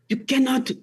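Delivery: mock French accent of a hurt teacher; teacher's French accent. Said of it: "I'm doing her accent again".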